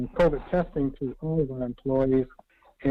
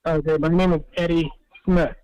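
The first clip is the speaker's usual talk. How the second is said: repeated louder and clearer; speaking up; 4 semitones higher, 3 dB louder than usual. Real, not acted. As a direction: speak up so he can hear. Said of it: "I can hardly hear you".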